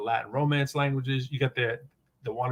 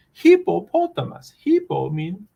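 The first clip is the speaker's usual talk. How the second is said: mock Greek translator; 8 semitones higher, 5 dB louder, slower than usual. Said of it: "that's my old Greek translations".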